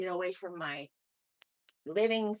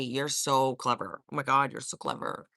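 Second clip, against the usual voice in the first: sarcastic praise; 6 semitones lower than usual. Pretend. Teasing the pet.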